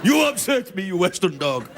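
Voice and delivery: put-on a touch of a weepy drunk Russian; mock Russian. Drunk Russian scolds a Westerner in a mock Russian accent.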